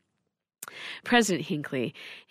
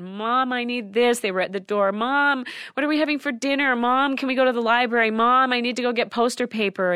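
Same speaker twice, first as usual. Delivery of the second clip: put-on higher, louder, insistent children all talking at once; clamouring kids; 6 semitones higher, 5 dB louder than usual. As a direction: play the kids pestering their mom.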